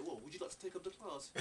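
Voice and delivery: lightly put-on sarcastic offer; mock sarcastic teacher. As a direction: imitate a teacher's sarcastic challenge. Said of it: "being sarcastic".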